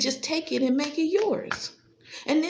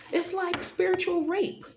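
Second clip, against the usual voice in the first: ordinary delivery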